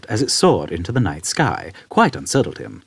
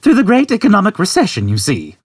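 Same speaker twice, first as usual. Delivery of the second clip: put-on high, louder old man voice; 12 semitones higher, 5 dB louder than usual. Old man explaining to Arthur, high and unconcerned. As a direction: explain it matter-of-factly.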